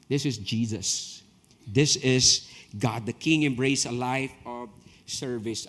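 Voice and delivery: low, slow, reverent proclamation; hushed preacher's awe; solemn declaration. Acted. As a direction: let each word land heavy.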